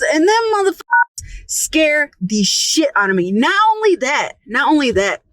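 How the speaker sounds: ordinary talk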